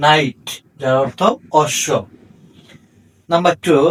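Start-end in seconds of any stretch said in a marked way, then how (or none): 0.1-0.4 নাইট said with no K sound, starting on N; silent K. Shown as a demonstration. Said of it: "কে উচ্চারণ হয়নি".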